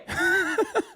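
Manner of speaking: high-pitched laugh. Real, not acted. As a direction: burst of high laughter after the bit. Laughs at own mock outrage.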